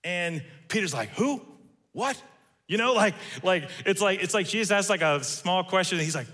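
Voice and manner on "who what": a quick, higher startled "who, what". Retold or imitated. Peter startled when the servant girl asks about Jesus. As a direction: caught off guard, playing dumb.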